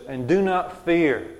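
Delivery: emphatic scripture command. Acted. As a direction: stretch the command out with force. Reads a biblical command emphatically.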